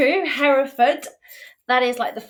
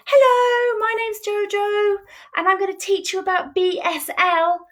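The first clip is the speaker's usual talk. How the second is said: much higher, animated and bright intro; expressive and lively; 11 semitones higher than usual. Shown as a demonstration. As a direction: sell it with lots of energy and feeling. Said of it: "if I was to say".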